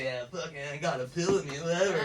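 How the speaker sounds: low, soft cocky mumbling to himself. Retold or imitated. Acting confident after a pill, cocky and low-key. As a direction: toss it off low and cool. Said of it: "I'm all confident and shit".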